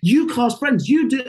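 high, eager, starstruck questions; starstruck fan. Retold or imitated. Meeting the casting director Barbara Miller, starstruck and high. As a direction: gush like a fan meeting a legend. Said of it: "And I was like".

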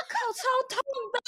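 laughing whine. Retold or imitated. Retold pained reply.

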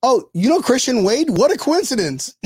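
high-pitched fake astonishment; hammed-up "what luck" delivery; feigned surprise. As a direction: play innocent, pretending it is pure chance. Play-acts chance meeting with coach.

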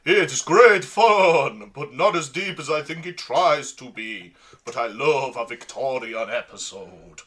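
slow, hammed-up Transylvanian accent, vampire laugh at the end; [name]'s Transylvanian accent. Host reads a listener tweet in mock Transylvanian accent. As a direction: read the tweet like a campy Transylvanian count. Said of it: "read it in [name]'s, like, Transylvanian accent".

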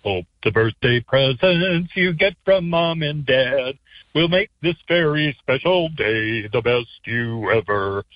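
normal talking voice

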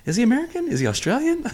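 very high, softly squeaked puzzled question; mock puzzled questioning. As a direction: pitch it way up, rising on each question. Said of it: "sometimes I'm like".

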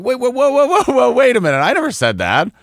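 high, loud alarmed backpedaling; panicked walking-back of words; alarmed protest. Retold or imitated. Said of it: "And he's like".

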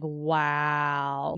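low, drawn-out wonder. Retold or imitated. Mother recalling feeling the baby move, in awe. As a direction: let the word sink in, low and long. Retells awed realization in pregnancy.

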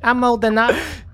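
put-on high, bratty teenage kid talking back; mouthy teen. Teen kid mouthing off to his mom, high and bratty.

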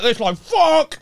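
high, strained retold curse; frustrated outburst. Rob retells his own frustrated outburst.

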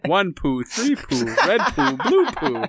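loud, high sing-song rhyming; Doctor Seuss rhyme. Pretend. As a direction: chant it like a kids' rhyme book.

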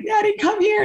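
high pleading call to a friend; calling Natty. Retold or imitated. Upset wrestler calling her friend over, high plea.

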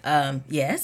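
low, quiet, hesitant answer; reluctant answer, kept small and low; wary reply. Retold or imitated. Answering a stranger in the parking lot, wary and quiet.

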